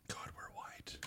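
hushed breathy whisper; whispered aside. Real, not acted. Self-mocking aside.

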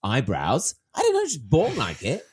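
exasperated protest. Acted. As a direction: plead loudly at being made to explain. Acting out exasperated reply to questioners.

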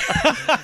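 bursts into a high laugh; hearty laugh. Real, not acted. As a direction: laugh it off, a bit embarrassed. Guest laughs at his own bias.

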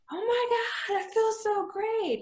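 very high-pitched mock gush; elated client. Client as the photo shoot gets done, elated gushing. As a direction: imitate a thrilled client up in a high register.

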